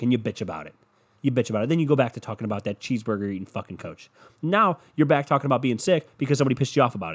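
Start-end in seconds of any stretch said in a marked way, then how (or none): none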